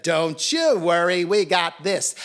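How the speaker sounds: loud, swaggering promise; cocky assurance. A narrator's reading. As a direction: brush off the doubt boldly. Cocky cats reassure the dog.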